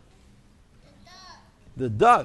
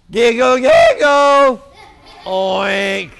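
higher, louder, slow piggy chant; giggling pigs; 4 semitones higher, 7 dB louder, slower than usual. Acted. Pigs chanting in the bath, high and loud.

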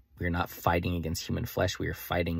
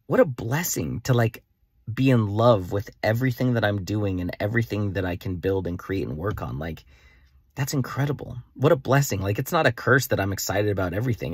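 higher, louder gushing enthusiasm; real excitement; 4 semitones higher, 5 dB louder than usual. Real, not acted. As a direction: marvel out loud, truly thrilled.

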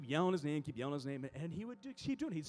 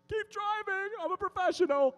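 loud, high holler to passing drivers; high-pitched defensive shout; shouting at onlookers; 11 dB louder than usual. Retold or imitated. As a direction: wave off the gawkers with a raised yell. Calling to gawking drivers.

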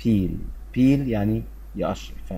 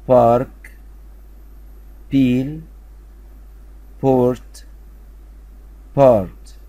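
each word called out much louder, with pauses to repeat; loud drill; 3 semitones higher, much slower than usual. Shown as a demonstration. Teacher calls out words for pupils to repeat, loud. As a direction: lead the choral drill, loud and clear. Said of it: "مرة تالتة".

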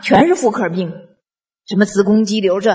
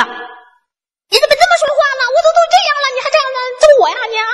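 hysterical outburst; much faster than usual. Retold or imitated. Sick friend on the phone screaming back in hysterical fury. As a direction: play the friend losing it completely. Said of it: "他听了以后更发疯了，发狂了，歇斯底里了".

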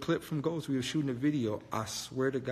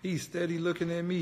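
usual speaking voice

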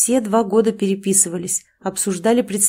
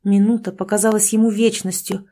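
usual speaking voice

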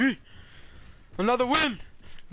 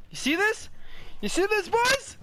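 excited bragging; 11 semitones higher than usual. Real, not acted. Bragging after a good play.

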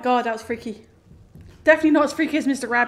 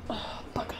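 whispered; 3 semitones lower, 15 dB quieter than usual. Real, not acted. Missed jump, caught.

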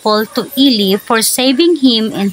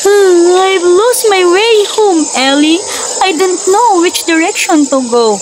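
feeling lost; 6 semitones higher than usual. Acted. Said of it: "feeling lost".

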